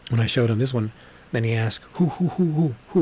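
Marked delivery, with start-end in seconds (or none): 1.9-3.0 quick, high-pitched volley of who's; excited retold questioning; eager questioning. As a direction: imitate someone eagerly asking around. Retelling man's eager questioning.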